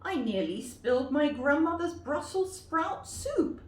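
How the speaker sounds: put-on high, loud, fast girl; girl scolding the wolf; indignant little girl. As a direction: fire the question at the wolf.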